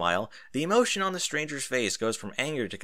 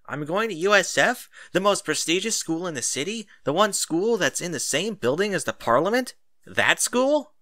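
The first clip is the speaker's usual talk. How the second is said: pitched high and louder, disbelieving; rising in outrage at each clause; incredulous kid; 7 semitones higher, 6 dB louder than usual. A narrator's reading. Kid learning which school, pitched high, louder and outraged.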